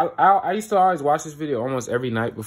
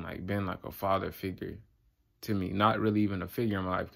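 low, soft, heavy-hearted voice; subdued confiding; 4 semitones lower, 5 dB quieter than usual. Real, not acted. Vlogger confiding to viewers about an absent dad, subdued. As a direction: open up quietly about something painful.

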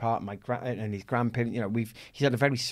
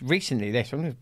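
ordinary delivery

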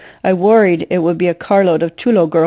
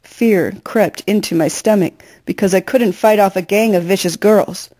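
usual speaking voice

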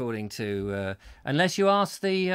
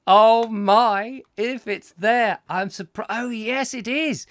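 surprised; 6 semitones higher, 7 dB louder, slower than usual. Real, not acted. Surprised at finding film.

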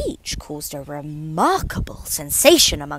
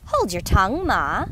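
louder, curt snap at mother; snappish; 5 dB louder than usual. A narrator's reading. Young crab snapping back at her mother, louder. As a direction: bite it off at her. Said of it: "a little snappishly".

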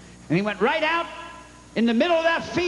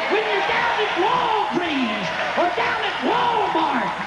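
preaching at full force; 6 semitones higher than usual. Acted. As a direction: whoop it up like a revival preacher.

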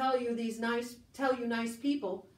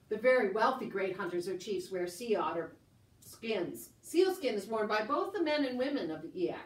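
ordinary talk

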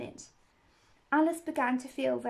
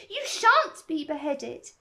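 firm promise; 4 semitones higher, 6 dB louder, slower than usual. A narrator's reading. Alice promises gardeners protection.

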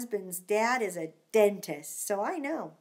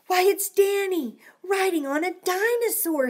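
excited; 7 semitones higher, 6 dB louder than usual. Acted. Child excitedly spotting Danny.

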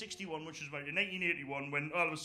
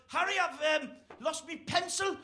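mock whining caller; 10 semitones higher than usual. Caller phoning about pencil.

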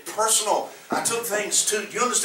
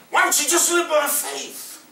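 higher, mildly mocking imitation; mocking the rich man; 9 semitones higher than usual. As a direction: lightly mimic the wealthy member. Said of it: "He didn't mean that like it sounds".

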